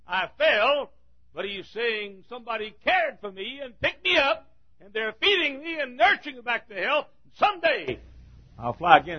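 lightly voiced psalmist, high and hopeful; voicing David. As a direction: speak as David with rising hope. David's words voiced in sermon.

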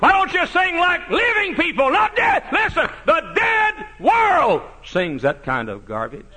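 high, loud preacher's cry; fiery preaching. Acted. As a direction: hammer it home from the pulpit. Preaching at full force.

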